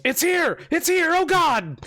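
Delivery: high-pitched terrified guard's cry; panicked yell. Acted. Guard spotting the thing from the woods, panicked yell. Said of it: "One of them yells".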